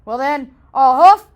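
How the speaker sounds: loud mocking threat; wolf laughing threat. Wolf mocking the pigs, loud and gleeful. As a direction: boom it out with a laugh. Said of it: "laughed the wolf".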